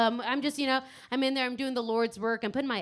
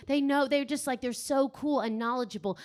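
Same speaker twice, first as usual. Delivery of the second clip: ordinary talk, nothing marked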